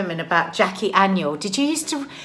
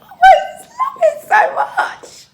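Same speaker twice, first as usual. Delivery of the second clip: high, slow laughing gush; 8 dB louder, slower than usual. Real, not acted. Gushing about dressing up.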